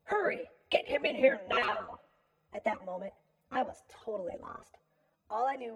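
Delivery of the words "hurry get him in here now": loud, higher urgent yell; yelled command, pushed hard. A narrator's reading. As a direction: shout an urgent order across the room. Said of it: "One of the men yelled".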